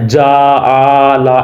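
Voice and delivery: جا آ لا said slow, with each vowel drawn out; stretched wrong way. Shown as a demonstration. Teacher shows the wrong drawn-out reading of جا آ لا. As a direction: drag جا آ لا too long to show the mistake. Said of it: "ایسے نہیں کرنا۔".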